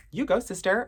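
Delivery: high, loud parody of scripted sass; mock a badly written 'You go, sister' line; high-pitched fake sassy cheer. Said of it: "sister with an R? Girl, no, nobody talk like that".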